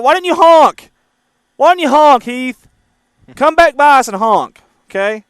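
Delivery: high, loud playful scolding; mock complaint. Real, not acted. Host teases viewer Heath for not honking, loud and high. Teasing viewer who drove past.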